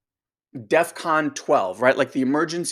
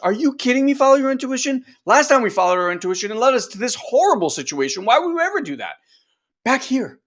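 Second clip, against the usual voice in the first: much higher, louder mock outrage; outraged inner part; 9 semitones higher, 5 dB louder, faster than usual. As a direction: scold in shrill disbelief. Inner part scolds the self.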